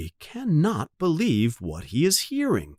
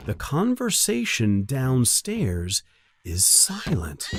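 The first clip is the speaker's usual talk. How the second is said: plain reading voice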